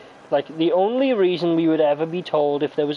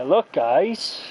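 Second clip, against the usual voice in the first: ordinary talk, nothing marked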